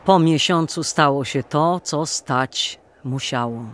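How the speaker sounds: ordinary delivery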